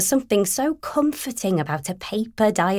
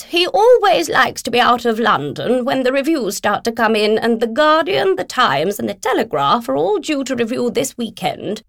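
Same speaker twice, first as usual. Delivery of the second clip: higher, brisk voice for a pushy agent on the phone; brisk, pushy, higher-pitched businesswoman; bossy literary agent; 5 semitones higher than usual. Acted. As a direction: play the bulldozing agent who won't wait. Philomena explaining the author's habits.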